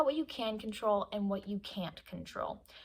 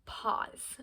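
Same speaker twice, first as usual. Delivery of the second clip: "pause" breathed out as a whisper. Shown as a demonstration. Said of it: "Um, yes".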